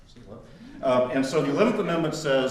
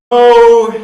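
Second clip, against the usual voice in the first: high, loud imitated professor refusal; mock stern no; 12 semitones higher, 22 dB louder than usual. The professor rejecting yet another case, loud mock refusal. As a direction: bark it out like the professor rejecting answers.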